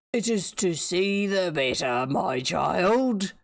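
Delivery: slow, lightly voiced wolf. The wolf answering the girl, slow and sly. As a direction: draw it out, sly.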